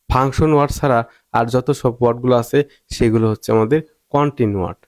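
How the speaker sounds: normal talking voice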